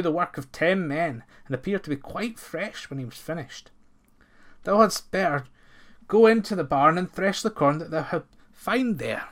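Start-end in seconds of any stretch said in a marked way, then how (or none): 4.6-9.3 slightly higher, louder farmer; farmer's voice. A narrator's reading. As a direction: give the order a bit loud. Master dully gives orders.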